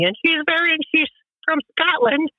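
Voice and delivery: put-on very high little-old-lady voice; elderly Scottish woman. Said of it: "little elderly woman who's, like, ninety".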